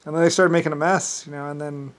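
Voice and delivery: usual speaking voice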